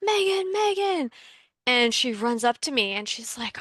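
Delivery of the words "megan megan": high, loud calls of a name across a food court; calling out loudly. Retold or imitated. Stranger calling across the cafeteria to the speaker, high loud shout. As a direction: call her name out high and loud, three times. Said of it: "this person shouting".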